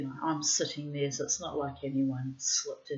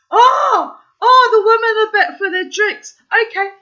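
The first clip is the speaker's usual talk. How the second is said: much higher and louder, laughing it out; shrieking with laughter while quoting him; laughing mock realisation; 20 dB louder than usual. Real, not acted. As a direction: play his dawning realisation through laughter. Imitating the lad, laughing.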